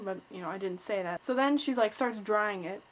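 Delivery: ordinary delivery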